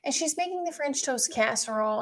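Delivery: ordinary talk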